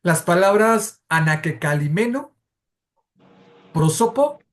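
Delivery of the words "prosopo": usual speaking voice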